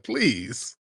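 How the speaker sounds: normal talking voice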